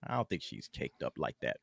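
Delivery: very low, hushed aside; quiet aside. Real, not acted. Co-host answering about Florence Pugh, in a low quiet aside. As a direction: say it far under your voice.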